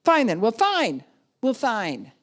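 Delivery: loud, high mock huff; hammed-up squabble, each retort punched; childish arguing. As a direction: snap back like a petulant kid. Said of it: "they don't sound adult-like".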